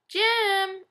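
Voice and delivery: high stretched two-syllable "Jem."; calling the cat. Retold or imitated. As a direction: call "Jem." lilting up high. Husband calls cat sing-song.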